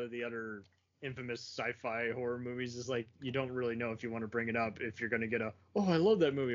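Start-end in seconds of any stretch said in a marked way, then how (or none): 5.8-6.5 higher, louder mock gushing; gushing fan. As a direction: act out an enthusiastic fan. Acting out a fan's reaction.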